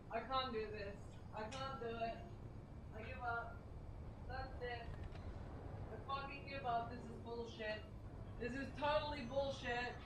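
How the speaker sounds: soft, quiet, deflated; defeated muttering. Real, not acted. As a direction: give up under your breath. Giving up on the game.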